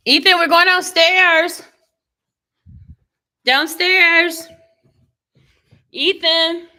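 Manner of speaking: loud high call across the house. Real, not acted. Calling child from downstairs.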